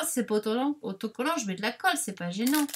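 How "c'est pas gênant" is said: ordinary talk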